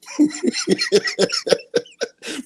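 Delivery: long high-pitched laugh; real giggling fit at own story; high giggle. Real, not acted.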